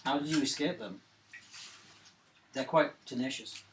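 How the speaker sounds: ordinary talk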